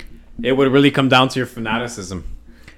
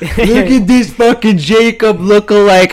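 high, loud, drawn-out jeer with a laugh; mocking exclamation; 8 semitones higher, 6 dB louder, slower than usual. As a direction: play loudly mocking a spotted enemy. Acted mocking reaction on spotting friend.

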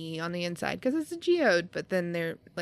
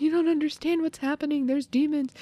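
higher, fast, insistent; mock panic; 8 semitones higher, faster than usual. She voices the boy insisting there are demons, high and fast. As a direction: blurt it like a scared kid nobody believes.

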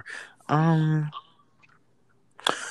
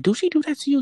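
much higher, incredulous question; much higher retold text; 14 semitones higher, faster than usual. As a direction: pitch it way up in disbelief. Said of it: "I asked her, like".

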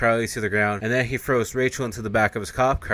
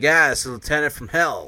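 mock excitement, louder and higher; excited; 5 semitones higher, 5 dB louder than usual. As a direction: act out an eager cheer at the sighting. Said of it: "he's getting all excited".

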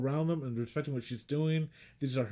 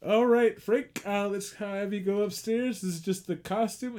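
high, slow soothing voice; lightly voiced handler calming Frank; 6 semitones higher than usual. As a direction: talk him down gently, acted. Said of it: "He goes like".

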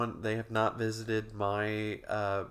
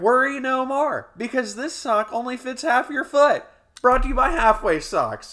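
much higher, loud hard-sell announcer; mock ad pitch; 13 semitones higher, 9 dB louder than usual. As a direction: belt out the spoof slogan. Reads a mock sponsor ad.

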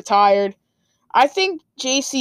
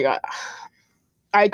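normal talking voice

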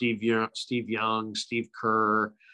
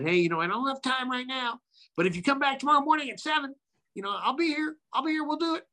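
imitating Tony Gwynn; faster than usual.

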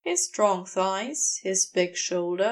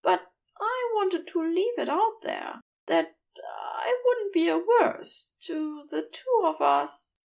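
lightly higher, gentle angel voice; Aziraphale voice; 6 semitones higher than usual. A narrator's reading. Aziraphale's voice in narration.

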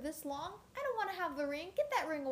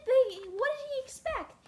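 exasperated; 13 semitones higher, 9 dB louder than usual. Real, not acted. Exasperated about Bilbo's party trick.

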